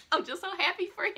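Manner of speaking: giddy joy. Real, not acted. Overjoyed fan gushing.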